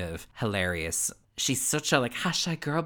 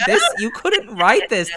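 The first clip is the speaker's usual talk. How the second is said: laughing disbelief; 12 semitones higher, 11 dB louder, slower than usual. Retold or imitated. Mock exasperated quote, laughing.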